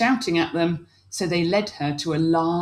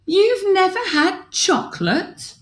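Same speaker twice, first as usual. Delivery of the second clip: high, louder disbelieving princess; shocked; 13 semitones higher, 4 dB louder than usual. Acted. Princess learning aliens never had chocolate, high shocked disbelief. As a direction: sound amazed anyone missed chocolate. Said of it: "was shocked".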